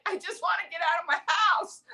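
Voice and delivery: high-pitched pleading whine; mock desperation. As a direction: act desperate to escape being stuck at home. Acting desperation to get out.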